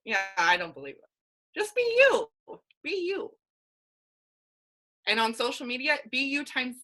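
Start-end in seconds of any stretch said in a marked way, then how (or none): 1.5-2.3 much higher and louder, punching "you"; emphatic exclamation. Real, not acted. Coach urging her team to be themselves, loud and high. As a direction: shout "Just be you" as a rallying cry.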